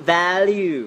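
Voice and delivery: value as "VAL-yoo"; correct pronunciation. Shown as a demonstration. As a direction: model value with a Y glide and falling end. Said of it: "ออกเสียงว่า".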